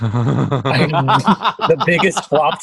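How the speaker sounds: slow, laughing through the words. Real, not acted. Cohost admits his episode flopped, laughing slowly. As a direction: chuckle it out, drawn out slowly.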